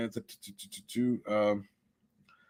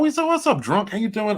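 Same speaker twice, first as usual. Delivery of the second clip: much higher, louder hello to a friend; excited greeting; 12 semitones higher, 4 dB louder than usual. Real, not acted. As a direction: greet him like you're thrilled he showed up. Greeting arriving viewer.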